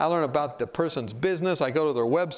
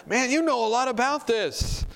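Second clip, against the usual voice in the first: retold amazement; 9 semitones higher than usual. Passenger impressed by his knowledge, amazed and high-pitched.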